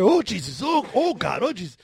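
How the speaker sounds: quick mock panicked cries; retold screaming. Storyteller shows how he was in his head, mock screaming. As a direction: re-enact the screaming, half-strength and loud. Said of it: "I was screaming".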